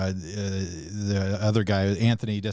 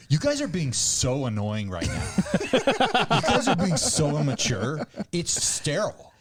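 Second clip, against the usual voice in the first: put-on aggrieved artist voice, rising higher and slower; pretentious comedian; 5 semitones higher than usual. Elevated comedian berates a booing crowd, pretentious and put out. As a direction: scold the crowd as if above them. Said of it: "like, the pretentious comedian who's above every".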